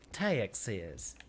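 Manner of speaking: Texas in a low Texan drawl, "Tah-ee-ix-iz"; Texan twang on a drawn-out Texas. Shown as a demonstration. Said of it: "stereotypical Texan accent would pre-, would pronounce it".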